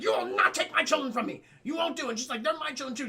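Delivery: high, loud tearful protest; Kay crying out. Retold or imitated. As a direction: plead loud and high like a mother. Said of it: "she's crying".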